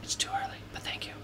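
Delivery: whispered reply; whispering. Acted. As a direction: whisper back playfully. Whispering back.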